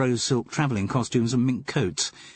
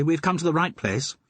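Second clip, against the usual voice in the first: boldly; 9 semitones higher than usual. Acted. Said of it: "said Twinks boldly".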